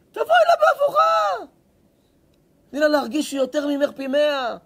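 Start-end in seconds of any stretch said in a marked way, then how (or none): 0.1-1.5 a high, loud burst of advice; loud, high shouted tip; sudden shout. Acted. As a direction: blurt it out loudly, high in the voice. Advising trick on daughter-in-law.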